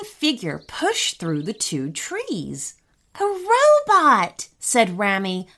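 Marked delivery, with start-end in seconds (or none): 3.2-4.5 high, loud, drawn-out cry of alarm; too-loud exclamation. A narrator's reading. Boy spots a robot in the forest, too loud. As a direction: blurt it high and stretch it out. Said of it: "a little too loudly".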